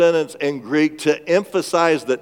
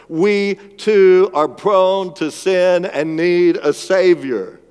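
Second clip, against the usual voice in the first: louder, higher, slow build to "Savior"; rising preaching; 6 semitones higher, 6 dB louder, slower than usual. Acted. Preacher reaching the climax of his point, full-voiced preaching. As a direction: lift it to a peak.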